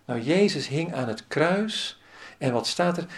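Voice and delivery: ordinary delivery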